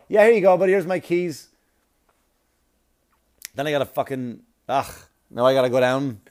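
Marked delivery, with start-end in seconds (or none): 0.1-1.5 awkward loud handover. Pretend.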